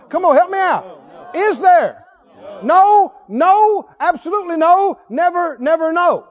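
rising from firm question to loud repeated no; building preacher shout. Real, not acted. Preacher driving the point home to his crowd, building to a shout. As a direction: build the questions until you shout the answer.

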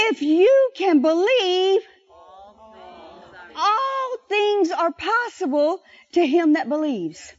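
slow, weighty line, every word leaned on; solemn, drawn-out scripture quote; slow emphatic recitation. Shown as a demonstration. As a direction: lay it down slow for them to repeat. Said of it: "Say that with me".

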